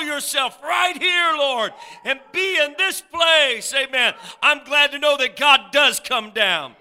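loud, high, fervent preacher; impassioned revival shouting; full-force preaching. Real, not acted. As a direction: pray and proclaim at the top of your voice.

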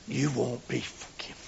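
hushed, grave whisper. Real, not acted. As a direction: warn them in a whisper. Sermon warning whispered.

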